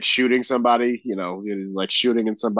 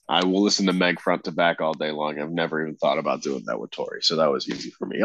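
ordinary talk, nothing marked

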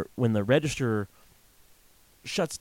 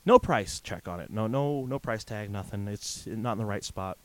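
normal talking voice

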